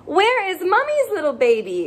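ordinary talk, nothing marked

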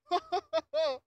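loud, high mock laughter; mocking fake laugh. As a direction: laugh derisively as the imitated blamer. Fake laugh as the victim-blamer.

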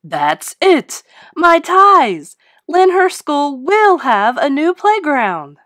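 loud high shout, slowing at the end; shouted eureka. A narrator's reading. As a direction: shout it with sudden excitement. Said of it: "and shouted".

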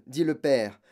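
ordinary delivery

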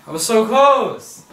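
loud, slow frustrated cry; so close, real frustration; excited. Real, not acted. Near miss while burp talking.

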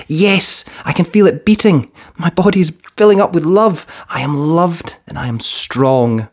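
put-on six-year-old feeling loved; happy little girl. A narrator's reading. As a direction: let the child's joy grow then settle. Girl affirms love, child voice.

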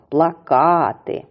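"Плакати" slowly, with long stressed -ка-; stress on second syllable. Shown as a demonstration. Teacher confirming where the stress falls, long second syllable. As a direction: stretch KA in "Плакати": pla-KAA-ty. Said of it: "припадає на другий".

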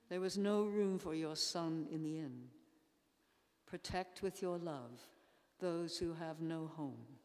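normal talking voice

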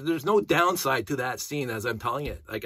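usual speaking voice

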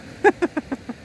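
quick high chuckle; amused little laugh; short high laugh. Real, not acted. Laughs at her own story.

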